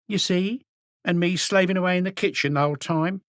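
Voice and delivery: high, self-pitying grumble; grumbling landlady. A narrator's reading. Rose grumbles to the inspector about slaving away, high. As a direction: moan about your hard work.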